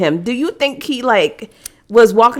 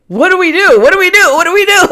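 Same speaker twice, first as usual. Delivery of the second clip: higher, louder fretting sailors; mock panic; 9 semitones higher, 4 dB louder, faster than usual. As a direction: act out a crew fretting in a storm. Acting out sailors in a storm.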